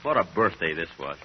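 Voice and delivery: low, subdued grumble to himself; glum muttering. Acted. Man coming home to a dark house, muttering glumly. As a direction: mutter dejectedly, pitch sunk low.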